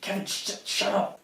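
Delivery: soft, urgent shushing; hushed shut-up. Acted. Silencing Kevin who spoils the bluff, hushed. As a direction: quietly silence your accomplice.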